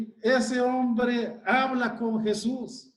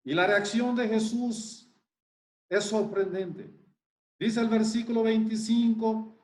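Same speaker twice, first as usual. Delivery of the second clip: ordinary talk, nothing marked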